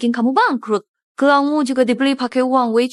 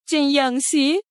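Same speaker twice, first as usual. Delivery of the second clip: ordinary delivery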